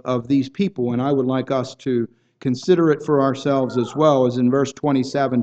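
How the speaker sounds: normal talking voice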